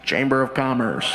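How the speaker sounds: very low, level monotone; fake official. Prankster answers through the door, deep fake-official voice. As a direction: drop far down into a mock-formal voice.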